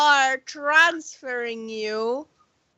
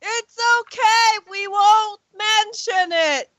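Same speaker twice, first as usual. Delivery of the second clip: calling back; 6 semitones higher than usual. Acted. Answering Gav's apology from below, calling back. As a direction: call reassurance up the stairs. Calls reassurance back upstairs.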